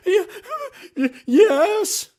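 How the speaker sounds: mock hysterical sob; hysterical crying. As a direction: blurt it out through tears, half laughing. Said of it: "she's sobbing and crying and hysterical".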